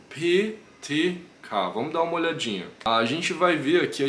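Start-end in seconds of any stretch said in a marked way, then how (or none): 0.1-1.7 P T K spelled slowly with strong puffs; slow, punchy P T K; explosive P T K. Shown as a demonstration. Teacher drills the class on aspirated P T K. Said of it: "Mais explosivo".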